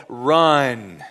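ordinary talk

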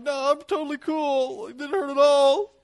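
put-on a kid who scraped his elbow insisting he's cool; mock tough kid fighting tears. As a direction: act like a hurt kid pretending he's fine. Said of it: "that one tear's just kinda coming out".

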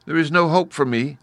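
put-on high, plaintive child's voice; understated girlish sorrow; mildly sad girl. A narrator's reading. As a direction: lament it like a little girl. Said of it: "she said sadly".

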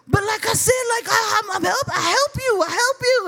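high-pitched stammering protest; mock desperate pleading. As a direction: imitate him begging his case, voice way up. Boyfriend pleading while escorted out.

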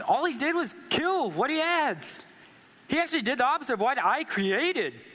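high, complaining protest; aggrieved Cain. Retold or imitated. Cain complaining to God that his work counts for nothing. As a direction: whine that it's unfair, pitch climbing. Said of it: "Cain was like".

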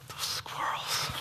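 slow, hushed whisper of blame; whispered joking grudge at the squirrels; mock whispered grumble. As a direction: hiss it like a private curse.

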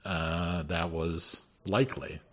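normal talking voice

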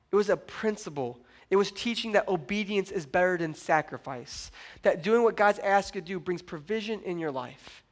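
ordinary delivery

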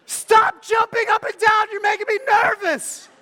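high, loud frantic parent yell; hammed-up shout on one high pitch; shouting back. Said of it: "we would shout back at him".

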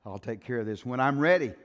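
loud, rising pulpit preaching. Acted. Preacher warning the church against defying God, in full preaching voice. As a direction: preach it hard to the congregation.

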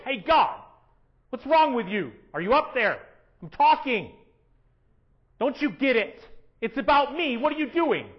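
mock irate prayer. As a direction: whine at God like a spoiled child. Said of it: "we become irate".

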